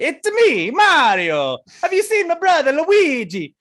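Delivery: high, loud cartoon Italian accent; Mario accent. Gamer playing with the boys, loud Mario accent. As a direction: belt it out as an over-the-top Italian Mario. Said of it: "started going into a Mario accent".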